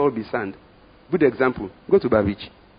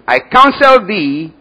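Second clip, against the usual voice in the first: slow, loud, higher proclamation; booming counsel; 10 semitones higher, 13 dB louder, much slower than usual. Acted. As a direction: draw out each word like a royal decree. Quoting Jesus' counsel, preaching.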